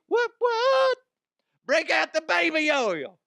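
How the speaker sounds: high squeaky mock party voice. Comedian mocking a [name] party guest, squeaky high. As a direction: shriek it like a thrilled party guest.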